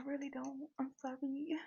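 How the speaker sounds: soft apologetic whisper. Retold or imitated. To the nervous cashier, whispering an apology. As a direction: breathe the apology with no voice.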